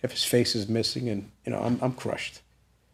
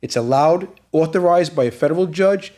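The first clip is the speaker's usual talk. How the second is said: raised, higher-pitched retold insistence; insistent captain; 4 semitones higher, 4 dB louder than usual. Captain citing the judge's order to the prisoner, firmly insistent. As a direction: lay down the judge's authority, point by point.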